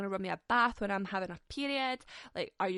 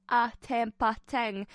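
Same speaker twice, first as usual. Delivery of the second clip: "attempting" stretched very slowly and louder; drawn-out emphasis; 6 dB louder, much slower than usual. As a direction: drag out "attempting" slowly, leaning on it.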